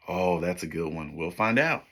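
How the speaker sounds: ordinary talk, nothing marked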